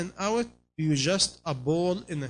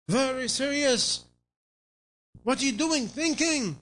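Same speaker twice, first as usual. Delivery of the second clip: mock serious thinker; 6 semitones higher than usual. Preacher mocks people who think too hard, high and pompous. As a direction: puff up like a self-important thinker.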